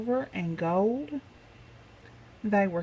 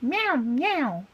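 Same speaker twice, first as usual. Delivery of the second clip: cat cry; 6 semitones higher than usual. Acted. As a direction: meow like a cat scaring crooks. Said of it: "Mabel cried".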